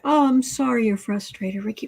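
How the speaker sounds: ordinary talk, nothing marked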